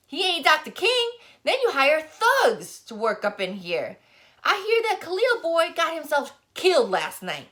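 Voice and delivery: put-on high, loud indignant rant from a grumpy old man. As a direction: pile on the accusations like an offended elder. Barber lectures Daddy angrily.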